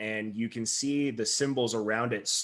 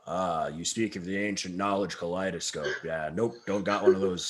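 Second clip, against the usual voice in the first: a bit lower and softer, mock-wise old merchant; lightly voiced shopkeeper; 4 semitones lower, 4 dB quieter than usual. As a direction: play the knowing shopkeeper, low-key. Shopkeeper answers player in character.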